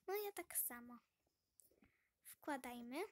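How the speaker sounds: ordinary talk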